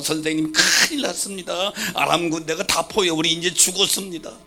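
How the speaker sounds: panicked. Retold or imitated. Panicked servant runs in.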